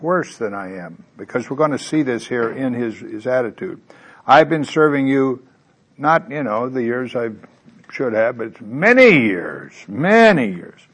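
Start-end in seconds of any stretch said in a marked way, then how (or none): 4.3-10.9 put-on louder, aggrieved older son; older son complaining to father, loud and self-righteous; self-righteous complaint. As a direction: insist on the many years, drawing them out. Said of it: "Isn't that self-righteous?".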